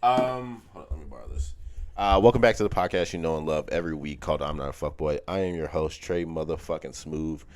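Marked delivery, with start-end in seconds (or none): 2.0-7.4 loud, lower-pitched announcer delivery; big podcast welcome, pausing between nicknames; podcast host intro. Acted. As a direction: announce yourself with showy host swagger.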